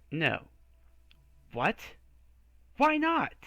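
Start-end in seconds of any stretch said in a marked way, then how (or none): 1.5-3.3 sharply higher, louder disbelief; outraged squeak of disbelief; incredulous. Acted. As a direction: hero stunned by the priest's refusal.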